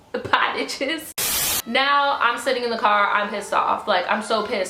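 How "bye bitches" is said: mock farewell. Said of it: "He was like".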